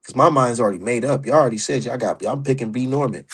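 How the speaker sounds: ordinary talk, nothing marked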